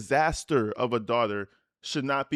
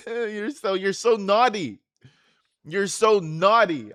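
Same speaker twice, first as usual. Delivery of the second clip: teasing; 7 semitones higher, 6 dB louder than usual. Real, not acted. Teasing daughter as naughty.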